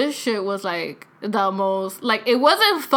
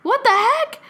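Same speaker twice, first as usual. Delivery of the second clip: loud, very high imitation; imitated shock, pitched way up; grandfather's surprised realization; 11 semitones higher, 8 dB louder, much slower than usual. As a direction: play a grandfather finally catching on, astonished. Grandfather finally understands.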